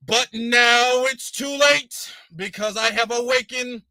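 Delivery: mock a grand speech to millions; hammed-up activist orator, stretching the big words; civil rights activist. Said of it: "trying to imitate some type of civil rights activist".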